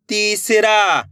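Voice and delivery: तीसरा with ती held long. Shown as a demonstration. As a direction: stretch each syllable of तीसरा. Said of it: "मेरे साथ साथ इनको रिपीट करना है".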